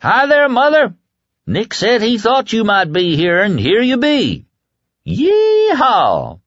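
high, loud whoop from a truck; joyful shout. A narrator's reading. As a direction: whoop it out with glee. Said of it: "he shouted and waved".

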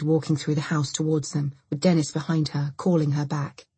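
usual speaking voice

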